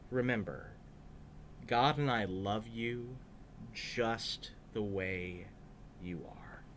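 slow, soft, fading to a whisper; hushed loving blessing; tender blessing. Acted. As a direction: gently reassure each child. Jesus blessing the children.

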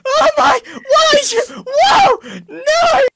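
high, loud panicked wail; panicked cry. Real, not acted. Friend startled by the TNT trap, crying out in alarm.

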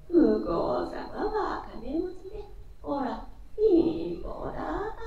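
slow, high, crying voice; tearful lament. Acted. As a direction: weep the words out slowly. Robbed man laments, crying.

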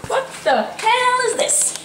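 high, slow outraged disbelief; mock surprise. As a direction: drag out the shock at the find. Finds mom's stuff in cupboard.

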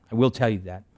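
ordinary talk

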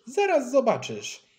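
put-on high, loud boyish challenge; shouted threat. A narrator's reading. Maksencjusz dares Gottfried to watch his somersaults, shouting. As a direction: call it out defiantly, pitched well up. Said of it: "Krzyknął Maksencjusz".

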